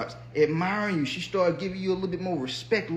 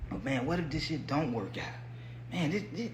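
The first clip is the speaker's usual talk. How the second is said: softer, doubtful muttering to oneself; retold doubts; 3 semitones lower, 8 dB quieter than usual. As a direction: replay a private worry under your voice. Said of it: "I've had my doubts".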